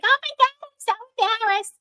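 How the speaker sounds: very high, loud imitation of embarrassment; mortified squeal. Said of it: "she got all embarrassed".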